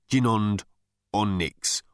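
"gin and onyx" slowly, split before "onyx"; phonetic variant. A narrator's reading. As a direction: pause before "onyx" as an odd alien name. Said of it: "variations on the same phonetic".

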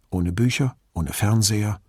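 ordinary delivery, nothing marked

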